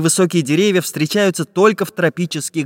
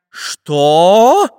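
loud, indignant, raised-pitch shout; menacing shout; 5 semitones higher, 6 dB louder than usual. A narrator's reading. Samodelkin answering the grabbing spy, shouting menacingly. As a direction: challenge them threateningly. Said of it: "грозным голосом закричал Самоделкин".

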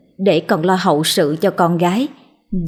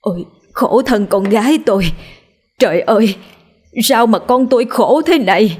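a touch of a sobbing lament; mother wailing over her sick daughter's bed; 3 semitones higher than usual. Acted. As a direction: grieve over your dying daughter. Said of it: "mà khóc rống lên".

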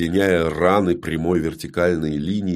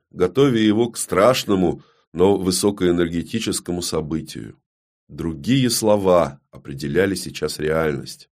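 usual speaking voice

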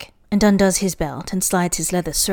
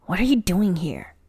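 usual speaking voice

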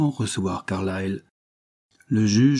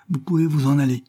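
higher-pitched call to the coachman; raised voice, mildly projected; 5 semitones higher than usual. A narrator's reading. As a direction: call out a dismissal. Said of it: "s'écria l'avoué en s'adressant au cocher".

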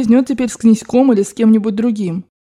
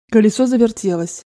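ordinary delivery

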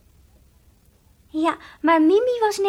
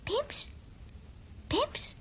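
tiny voice; 7 semitones higher, 11 dB quieter than usual. Acted. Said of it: "zei het stemmetje".

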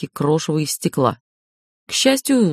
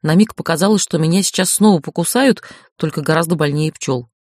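ordinary delivery

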